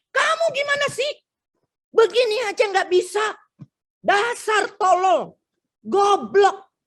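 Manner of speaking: put-on a parent berating a failing child, scornful and scolding.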